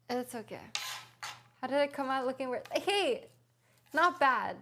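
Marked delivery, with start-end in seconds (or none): 2.7-3.3 excited hey. Real, not acted. Pleased with her chopping result. 3.9-4.6 pleased exclaim. Real, not acted. Pleased, repeating not bad.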